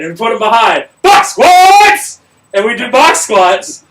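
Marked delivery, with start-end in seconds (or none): 1.0-2.2 loud, high barked command; drill sergeant yell. Retold or imitated. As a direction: bark it at the whole warehouse. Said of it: "I had the loudest voice".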